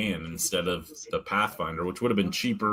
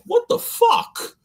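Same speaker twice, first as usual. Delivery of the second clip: much higher, slow what the fuck; incredulous outburst; 4 dB louder, much slower than usual. Real, not acted. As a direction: pitch up in disbelief, drawn out. Incredulous at friend's wife's claim.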